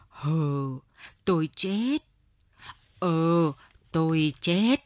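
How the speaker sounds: put-on groaning old man, drawn-out words; faint reply. A narrator's reading. Old man in withdrawal answering his wife, faint groaning reply. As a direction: answer feebly, with little strength. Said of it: "Lão khe khẽ đáp".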